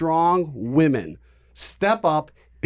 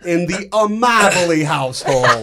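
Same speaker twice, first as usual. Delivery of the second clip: laughing; 5 semitones higher, 3 dB louder than usual. Real, not acted. Laughing jab.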